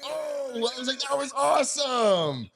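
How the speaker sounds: high, slow amazed voice; awestruck, drawn out. Retold or imitated. Amazed at quick birth.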